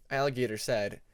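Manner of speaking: usual speaking voice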